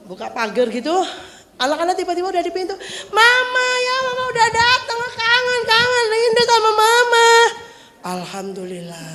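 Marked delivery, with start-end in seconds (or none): 3.1-7.3 put-on high, sing-song child greeting Mama; a little angel thrilled Mama is home; sweet angel child. (suara anak manis melengking menyambut Mama)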